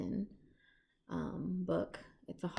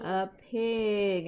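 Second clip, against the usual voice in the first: usual speaking voice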